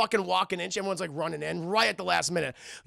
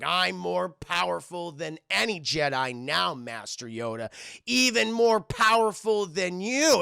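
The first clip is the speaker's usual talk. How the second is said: slow, grandiose villain boast; Count Dooku; slower than usual. Retold or imitated. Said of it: "He's like".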